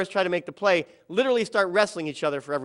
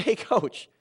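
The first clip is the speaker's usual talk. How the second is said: calling him back; 4 semitones higher than usual. Retold or imitated. Firmly calls angry coach back.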